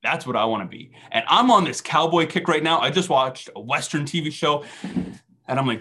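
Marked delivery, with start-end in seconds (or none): none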